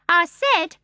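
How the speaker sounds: high, loud bird repeating; starling pressing the baby for an answer. A narrator's reading. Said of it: "he repeated more distinctly".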